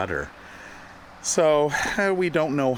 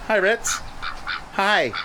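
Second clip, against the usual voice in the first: much higher, gentle hello; pet talk; 9 semitones higher than usual. Real, not acted. Greeting sleeping animal.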